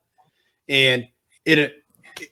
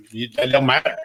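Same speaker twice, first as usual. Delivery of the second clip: "that don't matter" in a lightly put-on, offhand staff voice; mock dismissive staffer. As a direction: brush him off, half-voiced. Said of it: "And you go".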